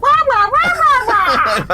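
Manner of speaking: high, loud mocking wah-wah; mocking baby wail. Brother mocks his memory with high loud wah-wah cries. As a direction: squeal it like a whining cartoon kid. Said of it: "It's like Charlie says".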